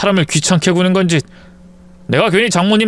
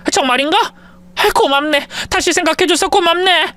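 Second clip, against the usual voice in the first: put-on higher, relieved old mother-in-law; grateful mother-in-law; 12 semitones higher than usual.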